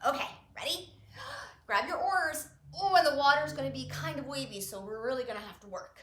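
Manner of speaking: ordinary delivery, nothing marked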